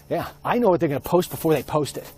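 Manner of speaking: ordinary delivery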